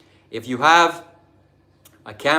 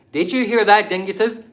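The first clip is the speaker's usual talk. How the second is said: mocking taunt; 8 semitones higher, 4 dB louder than usual. Real, not acted. Mocking the commenters.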